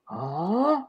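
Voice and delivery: long rising disbelieving "huuuh?"; stunned "huh". Retold or imitated. Clerk hearing he wants out of Islam, stunned rising huh. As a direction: imitate a drawn-out, climbing sound of disbelief. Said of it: "there is a sound from her".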